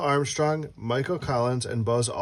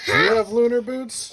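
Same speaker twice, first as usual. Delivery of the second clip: put-on much higher, louder teasing question to a child; playful high question; 9 semitones higher, 4 dB louder than usual. Parent teasing the child in a high playful voice.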